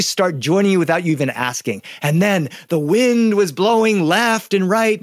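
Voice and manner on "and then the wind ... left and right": slow, higher singsong story narration; swaying, drawn-out read-aloud voice; storytelling. Shown as a demonstration. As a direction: stretch it out for listening children. Demonstrates storytelling with hand gestures.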